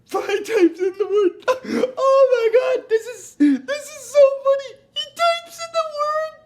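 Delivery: high, loud, crying with laughter; real helpless laughter, high pitched; laughing fit. Real, not acted. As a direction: barely get the words out through laughter.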